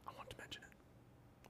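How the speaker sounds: slow, whispered afterthought; hushed aside. Real, not acted. Host closing his game pick, hushed aside.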